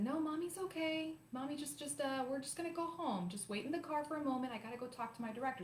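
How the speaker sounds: high, sing-song mommy voice; motherly reassurance. Retold or imitated. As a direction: cover the upset with a high, soothing lilt. Mother reassuring her child, retold.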